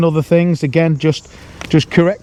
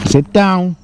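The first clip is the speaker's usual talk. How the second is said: firm voice. Real, not acted. Trainer firmly tells the dog to sit down. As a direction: tell him firmly to sit. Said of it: "firm voice just to tell him".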